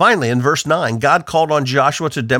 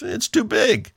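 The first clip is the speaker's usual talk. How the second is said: mock terrified voice; 8 semitones higher, slower than usual. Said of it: "A person who's terrified looks at the obstacles and says".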